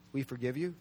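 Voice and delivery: low, soft, tentative offering; tentative suggestion. Acted. Preacher floats an answer to the congregation, low tentative suggestion. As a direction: offer it quietly, low and unsure.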